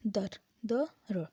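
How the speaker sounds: दर then द र pulled apart slowly; sounds split slowly. Shown as a demonstration. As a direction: spell दर out as द र. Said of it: "एक syllable". (दर को द र में तोड़ें)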